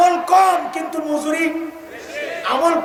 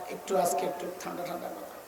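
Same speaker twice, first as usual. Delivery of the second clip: much lower, much softer, quick plea; soft pleading quote; 7 semitones lower, 14 dB quieter, faster than usual. Retold or imitated. Preacher quoting a friend begging for calm words, softly. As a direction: beg gently under the breath. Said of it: "Harun ভাই আমারে বহুত কইছে".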